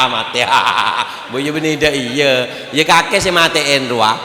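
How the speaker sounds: loud laughter through the words; laughing. Real, not acted. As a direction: laugh along with the crowd. Laughing with audience. (sambil tertawa)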